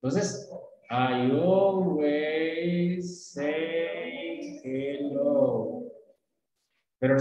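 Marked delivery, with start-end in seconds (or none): 0.9-5.8 very drawn-out, joined-up words; super slow, fluid. Shown as a demonstration. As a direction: stretch each vowel into the next word. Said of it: "súper lento y no necesariamente ... no lo digas fluido".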